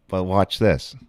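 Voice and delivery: ordinary talk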